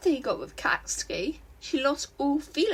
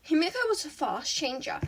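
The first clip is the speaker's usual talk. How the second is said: plain reading voice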